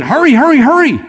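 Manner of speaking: a frantic father pushing Jesus to hurry; urgent hurrying. Retold or imitated. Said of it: "Jairus is probably saying".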